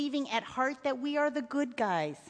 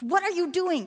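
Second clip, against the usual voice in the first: higher-pitched, urgent demand; angry father; 6 semitones higher, 3 dB louder than usual. A narrator's reading. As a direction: scold a child in alarm. Alarmed father scolds his son.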